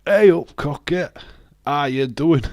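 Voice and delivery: loud, hammed-up Yorkshire greeting; Yorkshire accent. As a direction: call it out in broad Yorkshire. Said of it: "my Yorkshire accent would so stand out".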